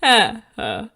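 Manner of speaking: loud, slow mock laugh, each ha spelled out; a spoken, not-quite-real stage laugh; Magenta's laugh. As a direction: ham up Magenta's deadpan ha ha ha. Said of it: "Wait, what does she say?".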